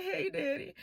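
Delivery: high, soft, imitated tearful greeting; mock weepy little-girl plea; crying. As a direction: whimper it as if in tears. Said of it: "but I'm crying".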